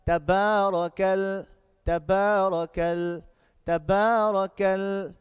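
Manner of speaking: slow, loud recitation with stretched vowels; measured, sustained tajweed delivery; loud Quran recitation. Shown as a demonstration. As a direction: chant it out word by word for a class to repeat. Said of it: "Then three times outside".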